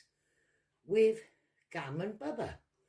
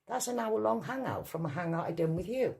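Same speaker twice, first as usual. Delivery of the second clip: ordinary talk, nothing marked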